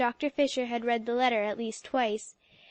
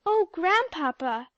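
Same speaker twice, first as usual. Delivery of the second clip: reproachful; 6 semitones higher, 4 dB louder, slower than usual. A narrator's reading. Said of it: "reproachfully from Polly".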